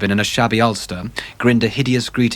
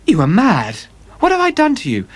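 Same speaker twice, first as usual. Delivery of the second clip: frightened plea; 10 semitones higher than usual. Acted. Dorian seized at gunpoint.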